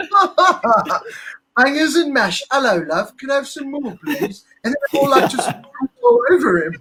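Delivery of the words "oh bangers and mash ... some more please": mock posh British accent, higher, laughing through it; hammed-up British accent. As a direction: ham up a silly British accent for the girls. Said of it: "because of your accent".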